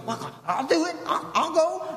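high, hesitant volunteer voice; little buckaroo. Acted. Little buckaroo volunteering to cross the fire, high halting voice. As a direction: pipe up haltingly as an eager little guy. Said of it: "some little buckaroo goes".

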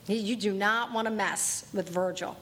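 ordinary talk, nothing marked

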